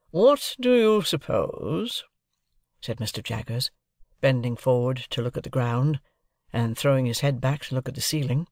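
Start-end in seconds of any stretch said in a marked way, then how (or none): 0.1-2.0 high, very slow questioning; probing lawyer. A narrator's reading. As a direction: draw the question out like a cross-examiner. Lawyer quizzing his ward.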